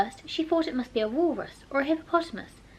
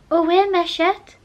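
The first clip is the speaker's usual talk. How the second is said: slow, loud schoolgirl French phrase; schoolbook French; 7 semitones higher, 9 dB louder than usual. Retold or imitated. Alice tries lesson-book French on the mouse, slow and loud. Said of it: "first sentence in her French lesson book".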